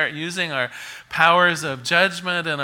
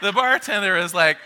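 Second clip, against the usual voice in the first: ordinary talk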